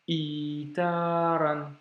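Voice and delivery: slow "itaran" with long vowels; blocked i, long i. Shown as a demonstration. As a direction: start "itaran" on a caught i and hold it. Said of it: "un petit blocage juste avant et ensuite le i long".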